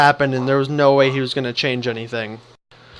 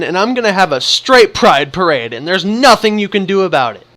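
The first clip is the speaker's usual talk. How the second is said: heated outburst; 4 semitones higher than usual. Retold or imitated. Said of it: "in the heat of the moment".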